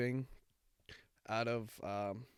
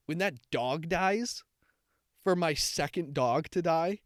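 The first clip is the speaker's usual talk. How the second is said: worked-up outburst; 5 semitones higher, 5 dB louder than usual. Real, not acted. Picturing her dog dying, a rising, loud, anguished outburst. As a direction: vent it like you'd come apart.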